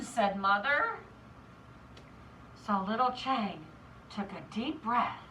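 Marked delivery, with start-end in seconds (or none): none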